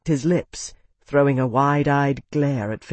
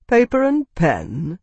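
slow, higher, disbelieving question; incredulous echo; 4 semitones higher, slower than usual. Acted. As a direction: query it in scornful disbelief.

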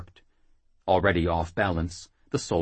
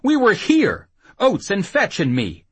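loud, high indignant protest; angry yell; 11 semitones higher, 7 dB louder than usual. Acted. Jackal insisting he was present, angry yell. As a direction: yell "we were here" as proof he lies. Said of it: "Jackal yelled".

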